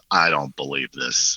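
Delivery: low, slow, stunned delivery; slow deadpan disbelief, retold; mother's disbelief. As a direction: imitate a mother dumbfounded, dropping the pitch right down.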